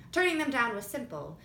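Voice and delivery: ordinary delivery, nothing marked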